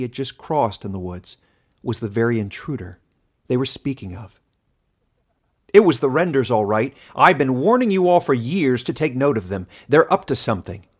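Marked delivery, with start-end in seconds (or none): 5.7-10.9 put-on loud, insistent old man warning; an old villager saying I told you so; stubborn old man, raised voice; grizzled old man. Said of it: "said one grizzled old man".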